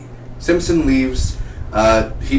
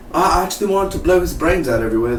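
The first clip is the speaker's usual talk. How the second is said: quick, higher put-on voice; imitated Kilgrave; 5 semitones higher, faster than usual. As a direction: rattle it off, mimicking a villain's casual boast. Acts out Kilgrave's boast.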